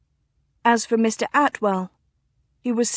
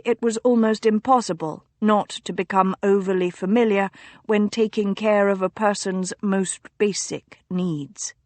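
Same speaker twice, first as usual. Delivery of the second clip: ordinary delivery, nothing marked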